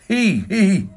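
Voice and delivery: spoken-out high cackle, two bursts; high mock cackle. A narrator's reading. Paleontologist teasing his guest with a secret, cackling. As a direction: cackle with gleeful mischief. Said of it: "Orlick cackled".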